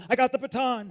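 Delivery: loud, high triumphant crow; gloating runner. Retold or imitated. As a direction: brag like a kid running off with the prize. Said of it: "a runner running off and going".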